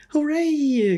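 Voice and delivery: high, loud cheer. A narrator's reading. Children cheering at the teacher's news, high and loud. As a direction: whoop it up like a class of kids. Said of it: "cried the children".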